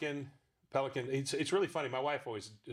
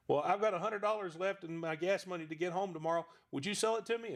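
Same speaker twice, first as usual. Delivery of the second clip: put-on eager young kid; 7 semitones higher than usual. Young self pleads to buy.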